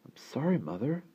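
put-on small, high child's voice; apologetic child. A narrator's reading. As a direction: apologise meekly to Mother. Sick child apologises to mother.

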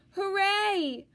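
a loud, high little cheer. Acted. Reading to kids, the monsters' loud, high hooray. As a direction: cheer like a happy space monster.

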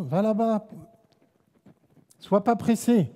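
ordinary talk

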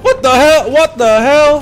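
high, very loud outburst; loud, high-pitched exclamation; startled shouting. Real, not acted. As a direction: yell it out twice in disbelief. Sudden outburst at game or stream alert.

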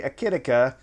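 Akitika with a short i, "kit" in the middle; own pronunciation. Shown as a demonstration. Host reading the written i his way, own pronunciation. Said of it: "I say".

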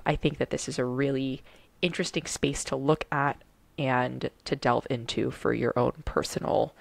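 ordinary talk

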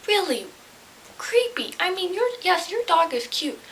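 high squeaky disgust; creeped out. Real, not acted. Scolding the man with the dog, high disgusted voice. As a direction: voice jumps up, grossed out.

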